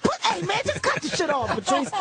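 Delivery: high, exasperated plea; pleading friend. Retold or imitated. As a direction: beg him to cut it off. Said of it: "So I'm like".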